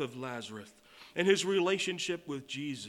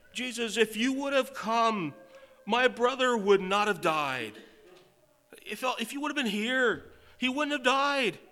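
higher, louder grieving plea to Jesus; a sister calling out her grief to Jesus; Martha's plea; 9 semitones higher, 9 dB louder than usual. Acted. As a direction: plead as the sister whose brother died.

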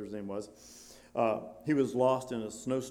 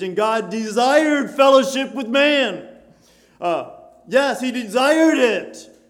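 raised, fervent pulpit challenge; fervent preaching, higher and louder; 8 semitones higher, 10 dB louder than usual. Acted. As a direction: press the question home to the people. Preacher building to a climax.

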